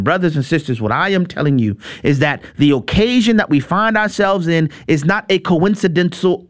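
ordinary talk

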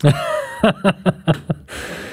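soft voiceless chuckle in a run; quiet laugh. Real, not acted. Host reacting to the fan's clip, soft chuckling. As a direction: laugh lightly under the breath.

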